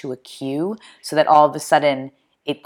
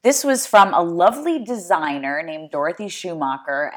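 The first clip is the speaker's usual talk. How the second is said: normal talking voice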